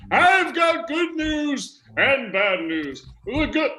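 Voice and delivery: put-on high, slow old man sliding into vampire; old man turned vampire. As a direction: play an ancient vampire, high-pitched and drawn out. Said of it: "now I sound a little more like a vampire".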